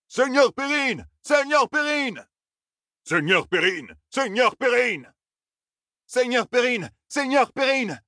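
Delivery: very high, stretched-out cheers; soldiers cheering. A narrator's reading. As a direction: hail your lord from the ranks. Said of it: "lancèrent d'autres soldats improvisés".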